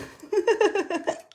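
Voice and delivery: high burst of real laughter. Real, not acted. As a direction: bubbly laugh at own joke. Laughs at her own joke.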